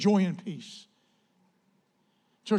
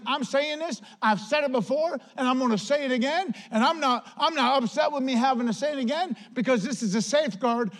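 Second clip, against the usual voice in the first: higher-pitched, insistent retelling; insistent apostle; 6 semitones higher than usual. Retold or imitated. Apostle repeating his safeguard to the church, insistent. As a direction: press the point home as the apostle. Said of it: "He's like".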